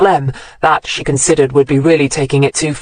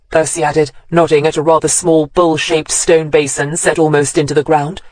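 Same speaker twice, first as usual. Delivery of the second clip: ordinary delivery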